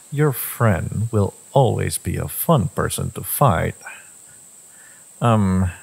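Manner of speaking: ordinary delivery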